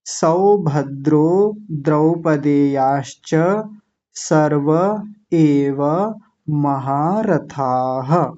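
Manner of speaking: verse said very slowly and carefully; teacher review; slow recitation. Shown as a demonstration. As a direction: recite slowly for learners to repeat. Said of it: "एक बार फिर से देखिए".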